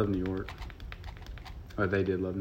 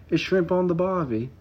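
imitating an Australian ad line; mock Australian accent; 9 semitones higher than usual. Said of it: "they're always like".

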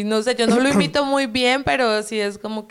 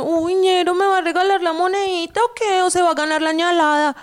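higher, louder Bogotá ñero street voice; Bogotá street ñero accent; 8 semitones higher, 4 dB louder than usual. Shown as a demonstration. Guest playing a Bogotá street hustler begging a coin, higher, louder ñero accent. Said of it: "el rolo en ñero es como".

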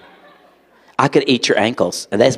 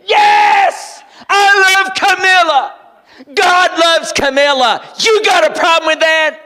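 much higher, louder, slow proud declaration; defiant shout; 5 dB louder, slower than usual. Retold or imitated. Billy answering the taunting bus kids, defiant shout. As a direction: stand up and shout them down.

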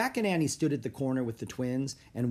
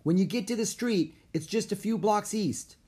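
high-pitched, raised-voice directions; calling directions; 9 semitones higher than usual. A narrator's reading. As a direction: call out the route to strangers. Kid shouting directions across park.

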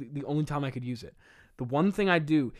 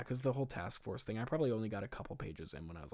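ordinary talk, nothing marked

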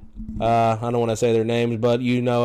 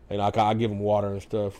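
ordinary talk

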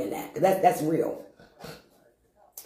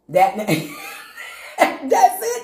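very slow, laughter through the words; laughing; much slower than usual. Real, not acted. Laughing at himself with the congregation. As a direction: crack up.

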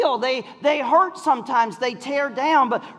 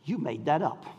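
much softer, low flat accusation; wife catching husband's fib, low and quiet; 9 semitones lower, 10 dB quieter than usual. Retold or imitated. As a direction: say it low, knowingly caught out. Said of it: "she goes".

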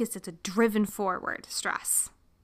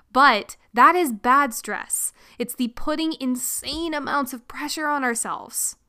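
ordinary talk, nothing marked

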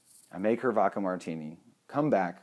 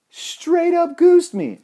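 much higher, louder and slow, stretching each word; drawn-out disbelief; 13 dB louder, much slower than usual. Retold or imitated. To the audience, insisting the guest really goosed him, slow and loud. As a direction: lean hard on every word in shock. Repeating for emphasis.

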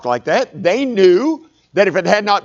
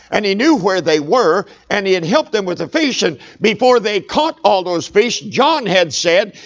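normal talking voice

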